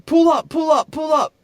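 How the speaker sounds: high, loud shouted plea; man begging the driver to block the car, shouted high; urgent shout. Retold or imitated. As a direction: yell at the driver to move now. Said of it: "he's like".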